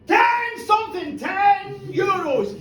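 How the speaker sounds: high, stretched-out shout; auction call. Acted. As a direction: call out the bid, slowing on it. Acted auction bid.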